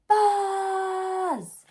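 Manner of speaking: loud high buzzing cry; fierce buzz. Acted. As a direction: charge in buzzing. Giant bee bursts in.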